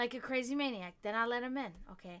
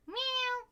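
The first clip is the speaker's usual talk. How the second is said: meow imitation; 13 semitones higher than usual. Answering the cat.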